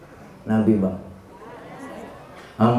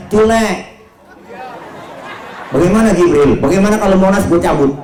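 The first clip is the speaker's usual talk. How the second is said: high, loud, comic bravado; hammed-up shouted offer of revenge; booming angel call; 8 semitones higher, 4 dB louder than usual. Jibril offering Tile to uproot Monas, loud and bold.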